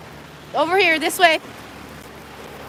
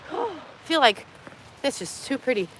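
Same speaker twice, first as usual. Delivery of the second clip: normal talking voice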